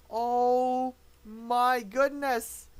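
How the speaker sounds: very slow, loud, stretched-out amazement; awestruck. Real, not acted. Commentator absorbing the upset, awestruck. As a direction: drag out every word in disbelief. Stunned by the sudden resignation.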